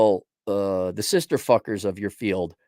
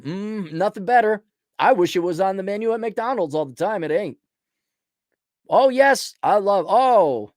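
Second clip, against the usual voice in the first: higher, savoring hammed-up ecstasy; mock rapture; 7 semitones higher than usual. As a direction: drool over it in exaggerated bliss. Mocking worship of corporate ideology.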